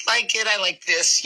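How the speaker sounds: high, imitated approving mother; lightly hammed-up motherly enthusiasm, pitched high; eager approval.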